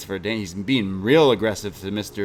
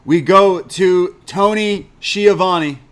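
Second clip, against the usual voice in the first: louder, slow, higher play-by-play call; announcer voice; 7 semitones higher, 7 dB louder, slower than usual. Acted. Recapping the show to a friend, hammed-up announcer. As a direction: announce it like ringside commentary.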